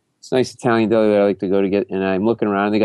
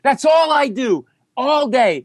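high, sarcastic mock rant; hammed-up, high-pitched fury; screaming; 10 semitones higher than usual. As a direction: rant on with absurd outraged confessions. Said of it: "and I'm screaming".

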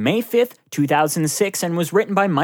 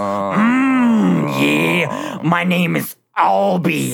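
bad Randy Savage growl; wrestling promo voice; much slower than usual. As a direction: ham it up like a wrestler. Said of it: "a really bad Randy Savage impression".

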